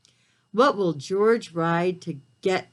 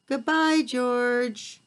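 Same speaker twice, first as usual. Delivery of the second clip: much higher, sing-song farewell; cheery goodbye; 7 semitones higher, 3 dB louder, much slower than usual. Acted. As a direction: wave the character off happily. Farewell to the character.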